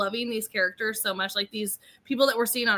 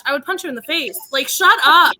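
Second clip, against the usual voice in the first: loud playful exasperation; mock angry outburst; 8 dB louder than usual. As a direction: vent in exaggerated fury. Venting at John, mock rage.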